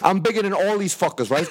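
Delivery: ordinary delivery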